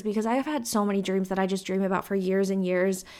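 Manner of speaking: ordinary delivery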